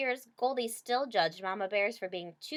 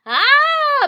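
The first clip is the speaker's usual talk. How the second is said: high, very loud screech; baby bear screeching in outrage; cub screech; 17 dB louder than usual. A narrator's reading. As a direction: let out a piercing little-bear shriek. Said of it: "Baby Bear screeched".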